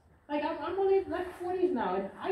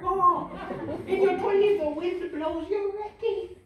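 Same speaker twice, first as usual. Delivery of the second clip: ordinary talk, nothing marked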